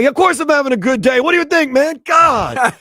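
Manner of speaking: high, loud exasperated snapping; grumpy vendor barking back at a polite customer; mock angry vendor. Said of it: "cultures where they always seem angry".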